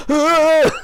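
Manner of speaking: high, loud re-enacted yell of fright; startled scream. Frightened cop reacting to the bloodied woman, screaming. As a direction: scream in mock terror, then laugh it off.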